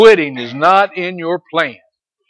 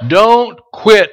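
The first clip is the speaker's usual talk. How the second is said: higher and loud, don't hit hard; loud exhortation; 6 semitones higher, 5 dB louder than usual. Acted. Preacher urging the junior Chris, loud and high. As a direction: command it, punch Don't.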